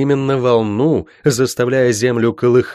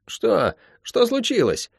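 put-on high, soft little boy's voice; high-pitched questioning kid; little brother; 11 semitones higher, 4 dB quieter, slower than usual. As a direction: ask like a startled small child. Child brother asks, alarmed.

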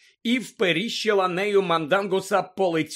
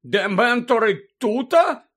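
loud, slow question rising high; stunned Мандангус; 6 semitones higher, 9 dB louder, slower than usual. A narrator's reading. Lookout checking the bad news, stunned. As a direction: ask it aghast and disbelieving. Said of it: "перепитав приголомшений Мандангус".

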